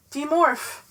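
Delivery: loud straining cry; desperate cry. A narrator's reading. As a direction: force the word out with the last strength. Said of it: "I cried with my last shred of control".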